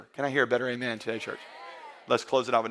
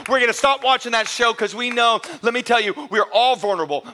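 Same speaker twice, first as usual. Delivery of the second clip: loud, high impassioned preaching; preaching at full force; 10 semitones higher, 8 dB louder than usual. Real, not acted. Pastor preaching hard to the church, loud and high. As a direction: preach it out, building to a shout.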